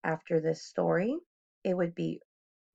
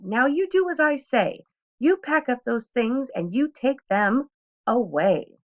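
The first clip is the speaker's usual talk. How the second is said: louder, firm command to the cat; firm order; 6 semitones higher, 6 dB louder than usual. A narrator's reading. Boy orders the cat to pack up, loud and firm. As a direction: tell the cat off firmly. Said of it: "Then I said to the cat".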